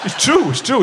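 a hint of wooden-teeth Alan; imitating Alan. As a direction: lightly play the old workmate insisting. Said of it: "And he said".

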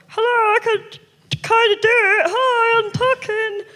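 high voice through still lips; squeaky ventriloquist dummy voice. Shown as a demonstration. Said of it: "Am I doing it?".

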